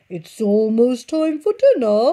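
slow, drawn-out sly purr; purring fox. Acted. Fox purrs to the birds, slow and sly. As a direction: purr it slowly like a hungry fox. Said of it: "he purred".

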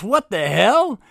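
high, loud startled cry; startled shout. Acted. Jack bursts in on the robbery, startled shout. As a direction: blurt it out in shock. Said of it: "He goes".

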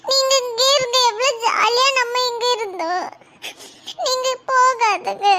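high tearful begging, slowing at the end; crying. Acted. Daughter crying, begging her father not to go abroad. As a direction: sob out the plea to stay. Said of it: "அழாதம்மா".

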